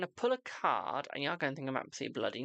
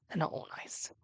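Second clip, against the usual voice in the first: hushed, secretive warning; whispered oracle warning; ominous whisper; 3 dB quieter than usual. Acted. Whispered aside about oracle warnings.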